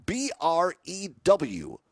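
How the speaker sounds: slow, letter-by-letter spelling; spelled out slowly. Real, not acted. Host giving listeners the contest word, spelled out slowly.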